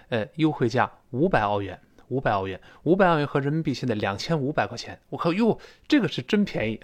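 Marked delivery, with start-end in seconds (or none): 5.1-6.8 higher-pitched bargain-hunter's exclamation; pleasantly surprised. Retold or imitated. Shopper spotting a cheap laptop, pleasantly surprised. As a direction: react in surprise at a great deal.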